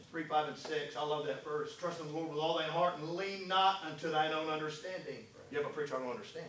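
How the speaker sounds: preacher lowering voice to a hush; quiet intimate preaching. Real, not acted. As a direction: draw the room in close and soft.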